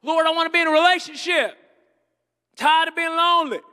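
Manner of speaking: hammed-up lonely prayer; whiny prayer. Preacher voicing a lonely churchgoer, pleading loudly.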